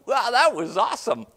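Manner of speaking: high, loud amazed praise; awed delight. Retold or imitated. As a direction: marvel out loud at what you saw. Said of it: "watching that going".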